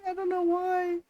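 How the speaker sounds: high, whiny fake sob; mock crying whine. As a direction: wail it like a pretend cry. Plain, then whined repeat.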